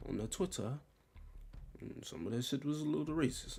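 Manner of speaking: ordinary talk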